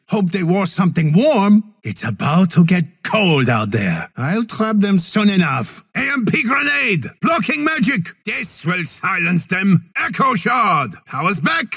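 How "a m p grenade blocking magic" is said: high shouted battle cry; high shouted ability call in combat; ability callout. Acted. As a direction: bark the ability name. Calling out an ability.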